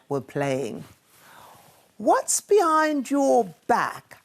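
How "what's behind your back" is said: high, slow little bear asking; eager small cub voice; young bear Douglas. A narrator's reading. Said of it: "asked Douglas".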